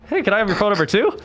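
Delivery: high, quick copycat pick-up line; eager chat-up. Retold or imitated. Copying his friend's move on the bartender, playfully eager. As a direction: pipe up hopefully, as a playful re-enactment. Re-enacts himself hitting on bartender.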